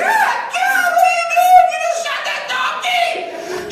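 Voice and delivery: high, loud horrified yell; shocked shout. Retold or imitated. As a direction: scream out in disbelief.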